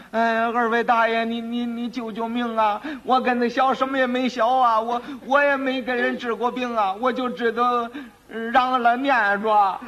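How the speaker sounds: high, wailing, stammering plea; crying child. Acted. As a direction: beg for your life like a weeping apprentice. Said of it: "跪那儿直哭".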